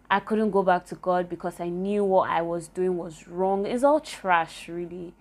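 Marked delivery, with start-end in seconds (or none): none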